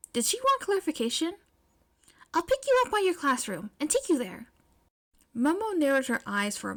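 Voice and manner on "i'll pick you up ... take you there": a touch higher, upbeat; girl proposing a plan, upbeat; lightly voiced eager May. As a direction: plan it out brightly.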